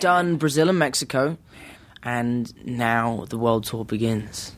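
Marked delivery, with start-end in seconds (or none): 2.0-4.5 movie trailer whisper. Pretend. Trailer-style announcement whispered.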